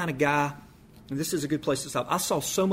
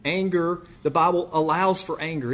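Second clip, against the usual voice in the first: ordinary delivery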